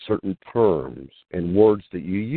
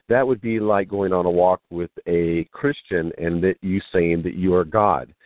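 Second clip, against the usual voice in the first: usual speaking voice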